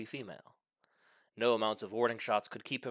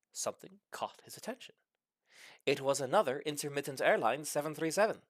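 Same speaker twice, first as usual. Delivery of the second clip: usual speaking voice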